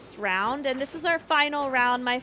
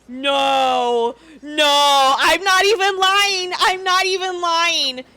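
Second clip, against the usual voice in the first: excited disbelief; 2 semitones higher, 5 dB louder, slower than usual. Real, not acted.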